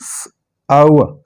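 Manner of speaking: स- hissed alone, then आवा; sounded out. Shown as a demonstration. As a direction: split it into स- and a gliding आवा. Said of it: "लेकिन ये होगा".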